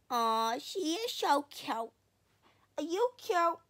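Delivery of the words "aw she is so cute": usual speaking voice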